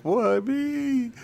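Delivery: a squeaky falsetto why me; mock wail. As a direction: whine it like a loser asking the heavens. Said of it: "they're like".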